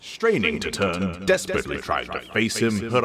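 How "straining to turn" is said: usual speaking voice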